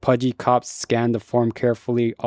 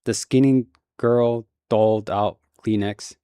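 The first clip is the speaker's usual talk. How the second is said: ordinary delivery, nothing marked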